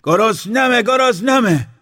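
a shrieking hungry scream. Acted. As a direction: scream it at the top of the voice. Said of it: "بلندتر فریاد کشید".

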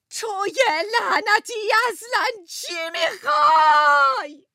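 high, slow, crying shout. Retold or imitated. Wife screaming at Luigi during the fight, slow crying shout. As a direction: scream it at him, near tears. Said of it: "سرش داد زدم".

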